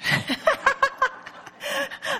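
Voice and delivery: high, long laugh; delighted high-pitched laughter. Real, not acted. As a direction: crack up at your own joke. Laughs at her own joke.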